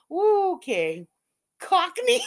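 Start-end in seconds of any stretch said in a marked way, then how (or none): 1.6-2.2 high British accent, hammed-up; put-on British accent. Streamer answering chat about Cockney, mock British accent. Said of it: "It's where my British accent comes from".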